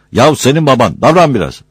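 loud, high impatient rebuke; scolding. Acted. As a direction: hurry them up crossly. Scolding slow risers. (sinirle, yüksek sesle azarlama)

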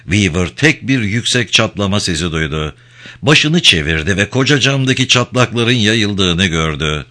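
usual speaking voice

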